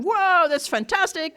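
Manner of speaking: high, drawn-out wow of a crowd; mock amazement. Whole meeting reacting to the story, mock amazement. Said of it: "Everybody went".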